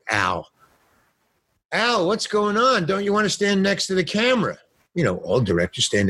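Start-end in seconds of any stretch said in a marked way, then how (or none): 1.7-4.6 imitated cinematographer. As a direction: call across the set in a high voice. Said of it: "the cinematographer says".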